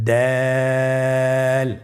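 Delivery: দাল held long for three alif lengths; দাল sung out long and steady; drawn-out letter. Shown as a demonstration. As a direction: stretch দাল slowly and evenly, like a Quran teacher. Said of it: "তিন আলিফ টেনে পড়ব".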